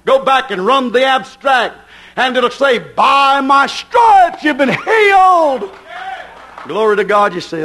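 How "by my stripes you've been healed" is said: slow, loud proclaimed shout; shouted scripture. Real, not acted. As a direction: proclaim it slowly at full voice.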